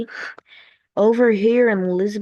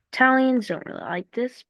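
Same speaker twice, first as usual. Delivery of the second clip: ordinary talk, nothing marked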